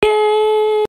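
high, loud cheer. Acted. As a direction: cheer for the win. Cheering the win.

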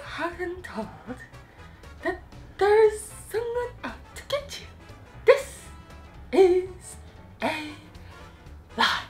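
Umbridge voice. Pretend.

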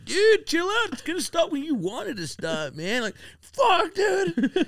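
very high, strained stoner voice; hippie friend. Retold or imitated. Hippie friend telling him to chill, high stoner voice. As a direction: imitate a spaced-out hippie squealing advice. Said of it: "it's just like the hippie friends".

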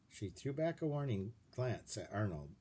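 usual speaking voice

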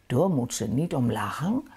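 ordinary delivery

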